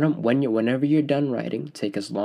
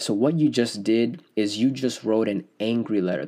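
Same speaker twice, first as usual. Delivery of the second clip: usual speaking voice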